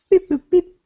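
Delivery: very high robotic beeps; old speech synthesizer. Pretend. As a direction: chirp like a little droid. Mimicking a computer hacking.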